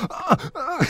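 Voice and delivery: high, loud faked moaning; hammed-up moans for a dubbing booth; mock porn-star moans. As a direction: imitate over-the-top pleasure gasps. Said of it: "felt like a porn star".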